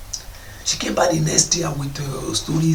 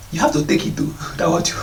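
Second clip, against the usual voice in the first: ordinary delivery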